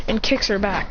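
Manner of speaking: ordinary delivery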